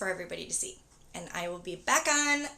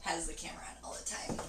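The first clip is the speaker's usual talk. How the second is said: whispering; 5 semitones lower, 10 dB quieter, faster than usual. Real, not acted. Aside about the camera.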